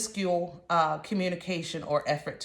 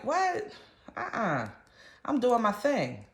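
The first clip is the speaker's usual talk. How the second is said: mock surprise; 3 semitones higher than usual. Imitated reply to gossip.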